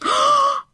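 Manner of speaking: high startled gasp; a quick high gasp of surprise; sharp surprised gasp. Real, not acted. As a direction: gasp in shock at the news. Reacting to cameo surprise.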